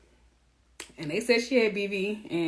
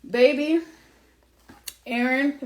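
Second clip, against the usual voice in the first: ordinary delivery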